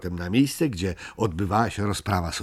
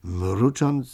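ordinary delivery

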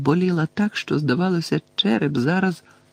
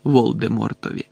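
ordinary delivery

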